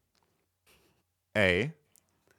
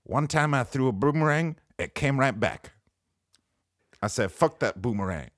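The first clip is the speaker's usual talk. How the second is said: Mitch Hedberg impression; 4 semitones higher than usual. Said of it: "I'm Mitch Hedberg".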